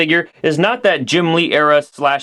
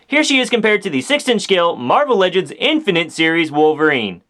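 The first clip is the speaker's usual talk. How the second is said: ordinary delivery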